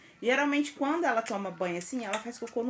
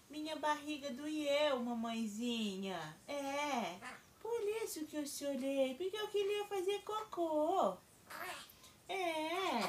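voicing the baby; 6 semitones higher, 9 dB quieter, slower than usual. Mom voices baby's reply.

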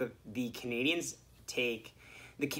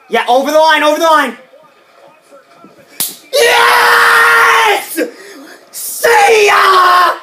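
screaming, much higher and louder; goal celebration shout; 23 dB louder, much slower than usual. Retold or imitated. Reliving the goal call, screaming at the top of his lungs. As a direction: roar it like the puck just crossed.

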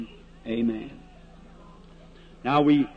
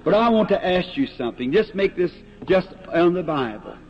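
rising, louder preaching push; fervent preaching; 2 semitones higher, 4 dB louder than usual. Acted. Preacher challenging strangers in the prayer line, fervent and building. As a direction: drive the question home to the crowd.

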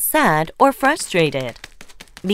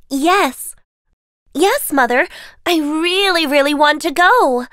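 higher, pleading with hope; eager Cinderella; 7 semitones higher than usual. Acted. Cinderella begging stepmother to attend the ball, eager. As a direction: beg to go to the ball.

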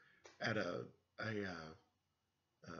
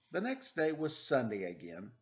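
put-on reading to children; 4 semitones higher, 5 dB louder than usual. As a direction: read it like a bedtime story for little kids. Reads picture book aloud to children.

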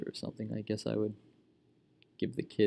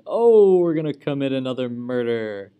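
gleeful; 2 semitones higher, 9 dB louder than usual. Real, not acted. Gleeful at an in-game murder.

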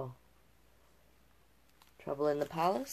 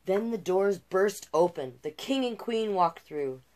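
gentle rhyming read-aloud; storyteller voice for a picture book; storybook narration; 2 semitones higher than usual. A narrator's reading. Reads the fairy tale aloud.

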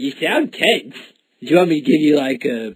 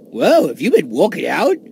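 ordinary talk

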